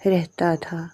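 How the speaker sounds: ordinary delivery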